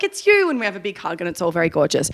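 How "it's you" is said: high, imitated gush; delighted greeting back. Fans greeting the performers back, delighted. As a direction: echo the greeting in a high, happy voice.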